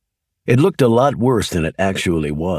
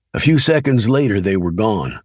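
plain reading voice

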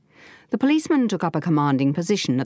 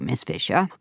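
purring; 6 semitones lower than usual. A narrator's reading. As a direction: purr the name, flattered. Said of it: "he purred".

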